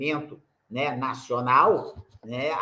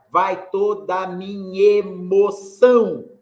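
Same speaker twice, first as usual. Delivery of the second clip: "Vai toda a minha emoção" slow, louder, minhemoção as one beat; scanning with elision; 3 semitones higher, 6 dB louder, slower than usual. Shown as a demonstration. Teacher demonstrating the elided seven syllables, slow scansion. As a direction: scan it slowly, merging nha and e. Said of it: "lá vai, ó".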